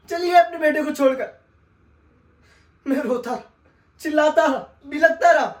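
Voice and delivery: anguished outcry. Acted. Telling the sahib his mother left him, anguished wail. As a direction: cry out the abandonment rising to a howl.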